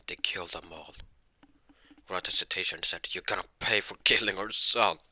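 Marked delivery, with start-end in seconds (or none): 3.1-5.0 louder vengeful outburst, acted; angry threat. Parents confront the bandits who killed their son, angry threat. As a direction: raise your voice and threaten revenge.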